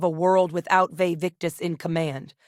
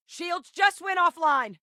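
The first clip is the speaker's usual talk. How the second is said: high, drawn-out urgent report; alarmed shout; 13 semitones higher, slower than usual. Acted. Crew member reporting lost shields, alarmed shout. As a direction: call out the damage in battle.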